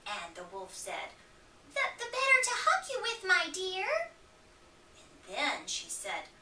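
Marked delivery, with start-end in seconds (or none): 1.7-4.1 quick, much higher sweet granny voice; fake granny voice. Wolf in Granny's bed still pretending, high and quick. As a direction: keep up the high granny act.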